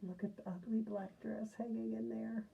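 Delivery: soft, hushed whisper; a secretive whisper to the listeners; whispered aside. Real, not acted.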